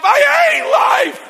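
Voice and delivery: very high strained squeal; comic pent-up outburst; holding it in bursts. Acted. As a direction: crack under the held breath into a squeal. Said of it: "I'm sucking it in".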